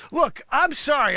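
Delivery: normal talking voice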